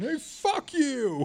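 high, slow yelled heckle; mock New Yorker heckle; New York heckler. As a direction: shout it from the background. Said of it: "you just hear the guy in the background".

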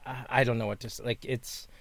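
low, soft, hesitant humblebrag; hushed deadpan self-praise; mock-modest. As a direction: drop your voice as if reluctant to boast. Mock-modest boast about his looks.

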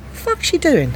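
high, incredulous indignation; outraged disbelief. Retold or imitated. Woman recalling queue jumper, high indignant disbelief. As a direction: mutter it in shocked disbelief.

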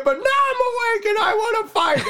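very high, hammed-up wife voice; wife spoiling for a fight. Wife standing on the bed, high mock challenge. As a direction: squeak out the challenge.

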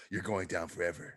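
very low, quiet menace; low, soft mock threat; mock action-hero one-liner. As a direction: drop it way down like a movie tough guy.

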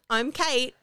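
normal talking voice

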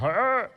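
short high loud growling roar; Chewbacca roar. Retold or imitated. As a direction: bark out a hairy-beast roar. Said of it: "I kind of picture Esau as Chewbacca".

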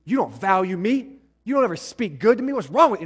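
loud, high-pitched angry confrontation; accusing outburst. Acted. Preacher acts out how not to confront a spouse, angry shouting. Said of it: "it doesn't need to be".

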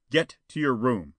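loud, mildly growled command; growled order. A narrator's reading. Spawn orders Jetta to her room, growling. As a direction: send them off with a growl. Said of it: "Spawn growled".

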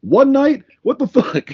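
high, loud disbelief; can't believe it; incredulous. Retold or imitated. Mock outrage at pajama purchase.

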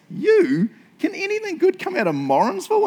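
high, loud, scornful questioning; mock disbelief. Churchgoer doubting someone from Morrinsville, hammed-up scorn. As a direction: put them down with exaggerated incredulity.

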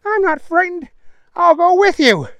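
very high, squeaky protest; Mike's high voice. A narrator's reading. Mike denying he is frightened, high henchman voice. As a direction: insist it loudly in a tiny high voice.

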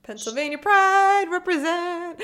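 high, loud hometown cheer; excited cheer. Real, not acted. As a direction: cheer it out proudly. Cheering guest's home state.

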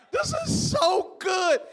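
slow loud shout of joy; real delight. Real, not acted. Pastor overcome by the scripture, shouting with joy. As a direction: bellow it out, overcome. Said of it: "That's how I feel right now".

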